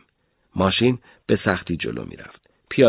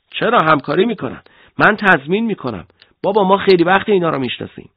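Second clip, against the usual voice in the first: high, loud assurance; insistent; 9 semitones higher, 5 dB louder than usual. A narrator's reading. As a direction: press your case emphatically, with raised pitch and volume. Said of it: "آنقدر اصرار کردیم". (با اصرار و صدای بلند)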